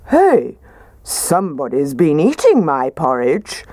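lightly voiced gruff father bear; a hint of a big gruff bear; gruff Daddy Bear. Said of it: "said in his gruff voice".

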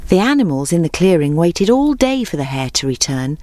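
usual speaking voice

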